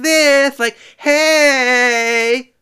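high, loud, camp mock voice; mocking lisp voice. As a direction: ham up a lisping caricature on "Hey". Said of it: "other ones talk like".

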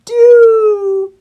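high, loud, slow wail; drawn-out cry of despair. A narrator's reading. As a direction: wail helplessly, stretching the words. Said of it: "cried Christopher".

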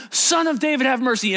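high, insistent call; crying out. Acted. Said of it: "he cried out all the more".

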